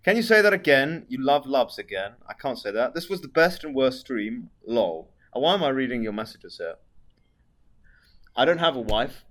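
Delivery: ordinary delivery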